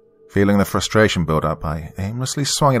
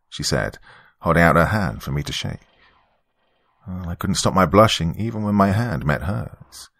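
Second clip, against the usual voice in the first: usual speaking voice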